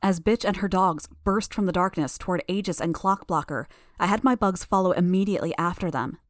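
ordinary delivery, nothing marked

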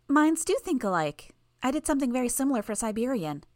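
put-on chirpy young girl, pleased with herself; girl's voice. A narrator's reading. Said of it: "A girl's voice".

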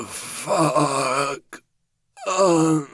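gasping in agony; groaning through the pain. Acted. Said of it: "not just letting out a long, guttural groan".

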